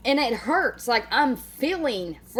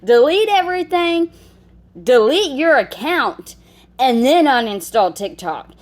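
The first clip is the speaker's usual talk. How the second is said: exasperated orders; 3 semitones higher, 7 dB louder than usual. Real, not acted. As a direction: lay down the law, hitting each word hard. Exasperated orders at the videoed mother.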